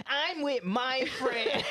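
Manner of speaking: high, loud, dragged-out whine with laughter; hammed-up childish excuse, laughing; mock first grader. Host mocks comedian's excuse, first-grader voice. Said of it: "first grader ass response".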